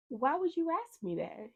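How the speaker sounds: high-pitched incredulous question; retold high, indignant demand; alarmed retort. Retelling her alarmed challenge to technician.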